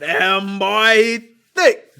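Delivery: high, booming exaggerated catcall; hammed-up gawking shout. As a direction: holler it in mock amazement, each word punched. Acted gawking at the elephant.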